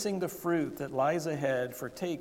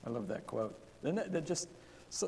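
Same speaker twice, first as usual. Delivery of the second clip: ordinary delivery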